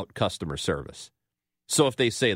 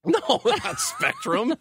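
laughing; slower than usual. Real, not acted. Denies guess while laughing.

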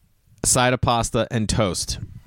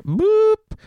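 very high, loud playful boop; silly high-pitched boop; comic boop; 5 dB louder than usual. Retold or imitated. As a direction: pop out a squeaky sound effect. Spoken sound effect after confession.

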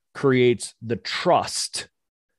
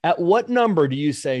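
higher, pointed question to a patient; challenging patient; 6 semitones higher than usual. Retold or imitated. Chiropractor retelling how he challenges a hesitant patient, pointed. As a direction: press the patient for his number. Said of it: "I'm like".

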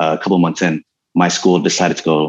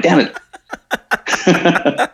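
high, laughing "damn it"; laughing mock frustration; 10 semitones higher than usual. Kid told he's too skinny for southern fist, laughing mock disappointment. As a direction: laugh through a pretend curse of defeat. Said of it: "I'm like".

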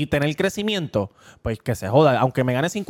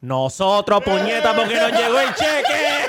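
laughing; 4 semitones higher, 3 dB louder than usual. Real, not acted. Laughing punchline about the check.

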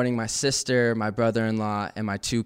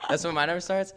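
ordinary delivery

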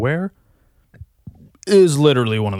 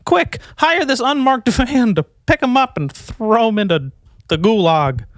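mock rich snob; 9 semitones higher than usual. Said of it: "you'd be like".

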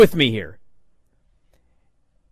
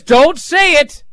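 high, loud, urgent plea; pleading mock yell; 9 semitones higher, 5 dB louder, slower than usual. Miz begging A J not to say it, loud plea. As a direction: beg him to stop, rising panic.

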